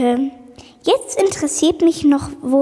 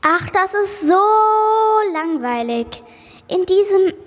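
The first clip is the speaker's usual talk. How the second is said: bored complaint; 6 semitones higher than usual.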